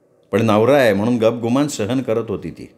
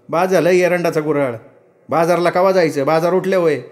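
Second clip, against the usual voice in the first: higher, snappy refusal; mild annoyance; 5 semitones higher than usual. Acted. Ratna refusing the servant's peanuts, mildly angry. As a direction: turn the gift away with pride.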